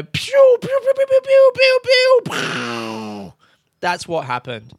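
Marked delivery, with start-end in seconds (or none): 0.0-3.3 high shouted pew pew blasts; loud playful laser zaps; laser sound effect. Retold or imitated. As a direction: blast out laser noises like a kid. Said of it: "the good guys go".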